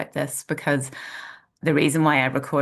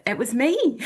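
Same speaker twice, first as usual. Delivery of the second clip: a playful hint of a posh voice, pitched much higher; 10 semitones higher, 3 dB louder than usual. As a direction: put on a light posh lilt for the reveal. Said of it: "So it must be my posh voice".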